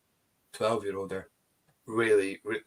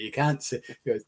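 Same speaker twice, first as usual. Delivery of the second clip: ordinary talk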